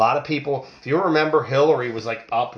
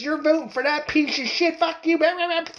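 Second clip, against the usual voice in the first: high, squeaky mock outrage; mocking angry leftist. Imitating someone spitting insults at a Trump voter, high and mocking. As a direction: shriek it like a ranting heckler, parodied. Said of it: "you get people spitting in their face".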